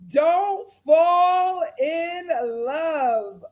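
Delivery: very slow, stretched-out plea; drawn-out warning. Retold or imitated. Host begging Faye not to fall, drawn-out plea. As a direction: draw out every word of the warning.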